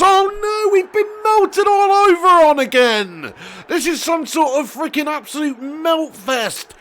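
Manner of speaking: high, drawn-out cry of despair; exasperated wail. Real, not acted. Gamer conceding another goal, exasperated high wail. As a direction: wail and rant in a high, slow voice.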